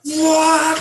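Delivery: loud, high retold "what"; stunned disbelief. Skater interrupted just before the trick, loud disbelief. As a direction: blurt it out in mock shock. Retelling his shocked reaction to the order.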